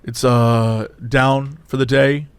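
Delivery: low, hesitant cashier; drive-through guy. Retold or imitated. Cashier answering an angry customer, low and subdued. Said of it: "you can hear the guy going like".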